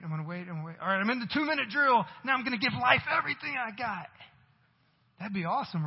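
normal talking voice

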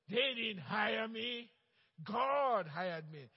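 very slow, loud, deliberate words; a believer calmly telling coworkers who really hired him, slow and loud; bold testimony. Acted. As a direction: lay down each word like a verdict.

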